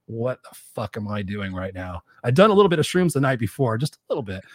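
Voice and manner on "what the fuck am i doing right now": muttered. Retold or imitated. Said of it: "And I'm like".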